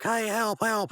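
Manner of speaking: high-pitched cry for help; calling for help. Acted. Mordecai calls to a car.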